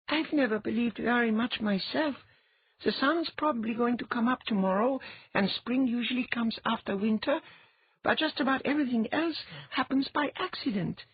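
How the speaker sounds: put-on high boyish voice, matter-of-fact; an offhand young boy explaining; boy Geher. A narrator's reading. Geher explaining Latha's gift to Ilya, boyish voice.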